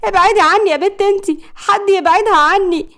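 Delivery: high, loud panicked cry; frantic high-pitched shout; screaming for help. A narrator's reading. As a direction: yell to get an attacker off you. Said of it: "منى كانت بتصرخ".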